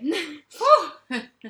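loud high laugh; loud rueful laugh; burst of laughter. Real, not acted. Laughing at sad reading.

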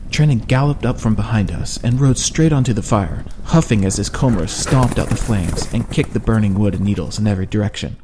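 usual speaking voice

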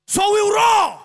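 roaring shout. Shown as a demonstration. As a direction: roar it out like a lion. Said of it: "You have to roar".

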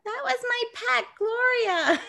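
high, mock-distraught little girl; tearful panic. Little girl whose caterpillar got swatted, high tearful panic. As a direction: wail it like an upset child, hammed up. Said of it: "bursts into tears in full panic".

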